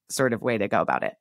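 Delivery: ordinary delivery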